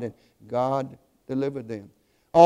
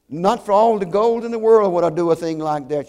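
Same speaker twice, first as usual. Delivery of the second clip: higher, louder, shocked protest; shocked outcry; 4 semitones higher, 7 dB louder than usual. A narrator's reading. Grieving mother to the pastor, shocked and loud. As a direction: recoil from the very idea, loudly. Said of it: "she cried out, shocked".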